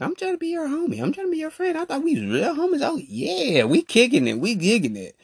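very high, fast pleading; quoting himself to a two-faced friend; high whiny self-quote. Retold or imitated. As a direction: whine like a hurt friend. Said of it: "I'm sitting there like".

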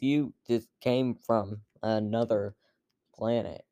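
ordinary talk

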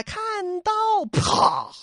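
battle cry. Acted. Storyteller voices a swordswoman charging, high battle cry. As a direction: cry out the strike, then burst "pah". Acts attack cry and impact.

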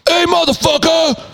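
high-pitched challenging shout; big tough-guy holler; manliest voice. Retold or imitated. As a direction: yell it at an intruder, as manly as possible. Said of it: "I said in my manliest voice".

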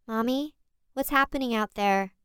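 put-on high, timid little boy; small child voice. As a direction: call out like a sleepy toddler. Four-year-old son calling.

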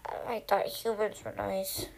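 low, soft murmuring; quiet, dropped-pitch mumble; hurt murmur. Acted. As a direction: murmur it low, almost to yourself. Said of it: "he murmured".

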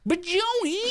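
high whiny nagging voice; obnoxious driver voice. Pretend. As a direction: whine like an annoying driver. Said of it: "That's my obnoxious driver voice".

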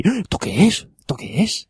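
put-on bewildered kids gawking at the pages; puzzled kids. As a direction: ask it like a baffled kid. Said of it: "estábamos todos ahí".

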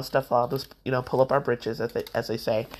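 ordinary delivery

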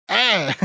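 short, high mock scream; imitated yelp. Igor startles them in the basement, high mock yelp. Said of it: "He's just like".

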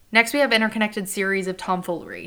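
normal talking voice

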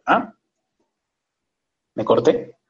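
ordinary talk, nothing marked

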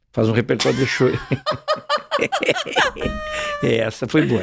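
laughing through the punchline; cracking up at his own joke; breaking into laughter mid-joke. Real, not acted.

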